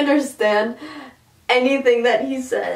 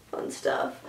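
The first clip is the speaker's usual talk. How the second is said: ironic whisper; 11 dB quieter than usual. Real, not acted. Ironic aside after breakup.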